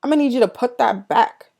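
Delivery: loud, high barked order "Hey, you"; a mocking bark from the brother at the kettle; stern brother's order. Brother ordering her to put the kettle back, loud and sharp. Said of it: "he turns around and he's like".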